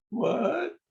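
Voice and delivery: a high, squeaky "What?" of disbelief; imitating shocked surprise. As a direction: play the stunned client's "What?". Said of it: "And everybody's like".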